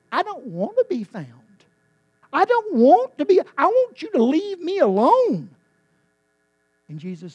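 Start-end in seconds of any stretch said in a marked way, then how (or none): 0.1-5.5 high, loud defiant sheep voice; stubborn sheep. Retold or imitated. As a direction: push away the shepherd, rebellious. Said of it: "It's a sheep that says".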